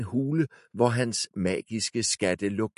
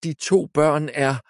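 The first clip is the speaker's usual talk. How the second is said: servant witch; 7 semitones higher, 4 dB louder than usual. A narrator's reading. Eddergrøn continues, cut off.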